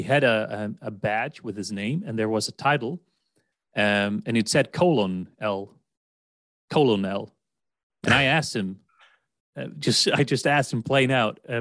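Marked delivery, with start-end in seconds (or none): 4.7-7.3 colonel read as COLON-EL; misread spelling pronunciation. Shown as a demonstration. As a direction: sound out colonel as "colon L", three beats. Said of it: "because it sounds like colon".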